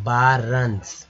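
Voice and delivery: slow बारंस, "baa-runs"; बारंस with long "baa", then "runs"; model pronunciation. Shown as a demonstration. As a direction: draw out बारंस for learners. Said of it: "क्या पढ़ेंगे?".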